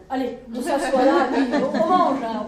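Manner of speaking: joie de manger. Acted. Soldat ravi devant la nourriture.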